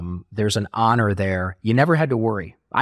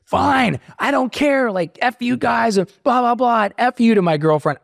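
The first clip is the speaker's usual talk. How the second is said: blowing up; 12 semitones higher, 4 dB louder than usual. Retold or imitated. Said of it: "I blew up at them".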